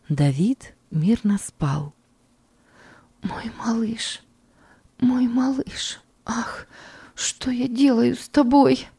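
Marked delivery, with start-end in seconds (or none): 3.2-6.0 soft breathy whisper, slow and high; мать шепчет своему малышу; whispered to the baby. A narrator's reading. 6.2-8.9 high anguished cry, lightly voiced; a mother's despairing exclamation; mild anguished outburst; exclaiming in despair. A narrator's reading. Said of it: "воскликнула она".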